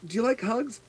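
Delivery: put-on high little-girl ask; childlike question. Sea elf child asking Connery for a hug, high. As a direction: ask sweetly like a small child. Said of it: "she goes up to Connery".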